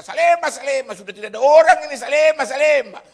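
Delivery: very loud, high bus tout's holler; shouting. Retold or imitated. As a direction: bellow the stop to an empty street. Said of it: "Dia teriak-teriak".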